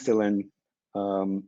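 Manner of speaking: usual speaking voice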